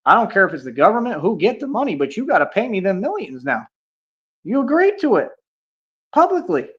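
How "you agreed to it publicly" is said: much higher, triumphant jab; gloating. Real, not acted. Streamer to a chatter who owes him millions, high gloating jab. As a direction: rub it in, pitch shooting up. Gloating over owed money.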